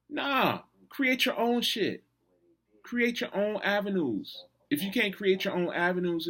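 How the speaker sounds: fired up. Real, not acted. Fired-up advice to listeners.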